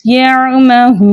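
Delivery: यअमहून with the oo drawn out long; slow held oo; long madd. Shown as a demonstration. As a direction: stretch the oo of यअमहून six beats. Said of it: "हम कैसे पढ़ेंगे?".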